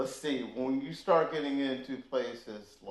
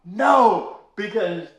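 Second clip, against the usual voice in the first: hostile stranger; 4 semitones higher, 8 dB louder, slower than usual. Retold or imitated. Nazi insulting him at party.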